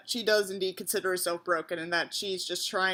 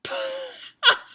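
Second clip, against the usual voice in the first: incredulous laugh. Real, not acted. Laughing at story's reveal.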